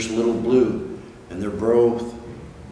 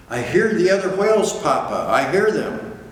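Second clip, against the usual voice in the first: quick, higher little whale voice; excited child; 6 semitones higher than usual. Acted. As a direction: blurt it out with delight.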